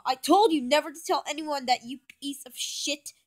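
mild angry yell, loud at first. A narrator's reading. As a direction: scold with a burst that drops off. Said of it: "Joey yells at Bendy".

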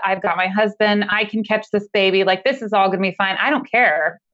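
ordinary talk

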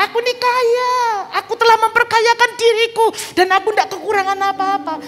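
high, loud, proud boasting; mock boast. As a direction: puff up like someone bragging. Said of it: "kita sering berkata begini".

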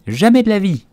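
high, loud outraged refusal; offended mouse at full pitch; angry retort. A narrator's reading. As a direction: fling back an indignant refusal. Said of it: "rétorqua la souris".

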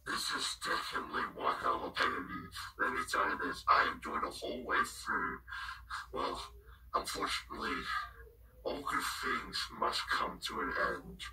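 hushed whisper calling the show; whispered commentary. Real, not acted. Host whispering the pay-per-view announcements. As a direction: keep it all a whisper like a secret broadcast.

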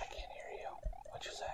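barely audible whisper. Pretend. Co-host playing along with silent episode, whispering. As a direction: whisper it, very faintly. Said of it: "that's why we're whispering".

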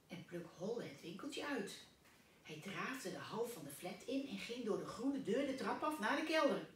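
usual speaking voice

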